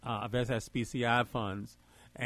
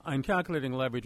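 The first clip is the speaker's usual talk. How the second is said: speaking up; faster than usual. Real, not acted. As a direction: raise the volume to be heard. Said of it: "can you hear me now".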